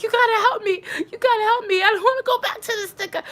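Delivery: high, loud, urgent begging; desperate retold plea. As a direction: beg a friend for rescue, acting it out.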